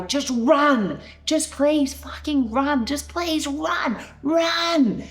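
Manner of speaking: high, drawn-out desperate pleading yell; screaming. Retold or imitated. Wife urging a stranger to escape her husband, screaming. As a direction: beg him to flee, building to a loud cry. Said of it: "I'm screaming at this guy".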